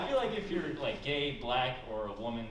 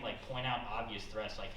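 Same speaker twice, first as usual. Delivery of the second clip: normal talking voice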